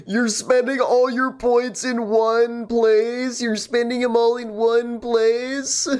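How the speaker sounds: high, slow playful teasing. Real, not acted. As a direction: tease chat about splurging.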